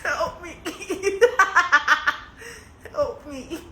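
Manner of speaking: breathless laughing cries for help; begging for help through helpless laughter; laughing plea. Real, not acted. Streamer laughing at own pain, pleading to chat.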